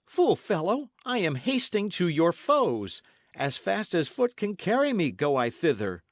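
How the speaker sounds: urgent Dick, a touch angry; Dick, exasperated. Acted. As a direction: scold the fool and hurry off. Dick scolds Matcham.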